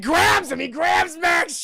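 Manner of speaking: high, loud shouted storytelling; sudden climactic yell; explosive narration. A narrator's reading. Climax of the retold attack.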